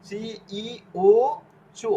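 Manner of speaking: চি, ই, উ said separately, then চু as "choo"; spelling drill. Shown as a demonstration. Teacher drilling Italian sounds, slow letter-by-letter spelling of চু. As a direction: space out each letter, ending on চু with a ch. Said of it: "চ এর উচ্চারণ করার জন্য".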